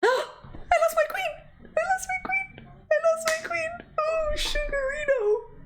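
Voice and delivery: high, hammed-up lament over the lost queen; mock wail. Mock wail after losing her queen.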